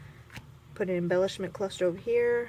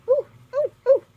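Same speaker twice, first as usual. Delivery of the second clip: high sharp louder ooh at a sudden bite; startled ooh; 11 dB louder than usual. Real, not acted. Crafter feeling a sudden bite on her skin, startled yelps. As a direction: yelp it like something just bit you. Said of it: "I feel like someone's biting me".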